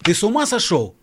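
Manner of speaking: angry confrontation. Acted. John confronting Paul.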